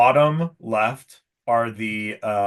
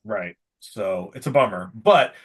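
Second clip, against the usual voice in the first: ordinary talk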